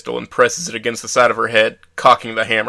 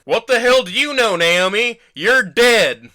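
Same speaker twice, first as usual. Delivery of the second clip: much higher, loud furious yell; angry shout; 11 semitones higher, 7 dB louder, slower than usual. A narrator's reading. Man yelling at his girlfriend's ghost, furious shout. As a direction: scream it at her, pointing. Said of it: "my angry response".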